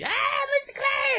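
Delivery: put-on high-pitched squeal of mobbing children; excited little kids. Little girls rushing over to greet their neighbour, high mock squeal. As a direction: mimic kids shrieking a greeting. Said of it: "they come over and they".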